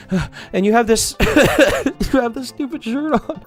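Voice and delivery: laughing. Real, not acted. A commentator cracking up at the salesman's shirt. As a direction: laugh your way through it.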